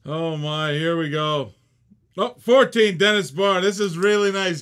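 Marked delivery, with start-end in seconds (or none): 2.2-4.6 excited call. Real, not acted. New bid arrives, auctioneer reacts.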